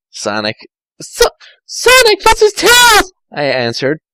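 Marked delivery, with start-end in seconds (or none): 1.0-3.1 put-on high, fast girlish voice; Tails, sweet and eager; young girl Tails. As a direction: chirp it quickly like a young fox girl. Tails calls Sonic.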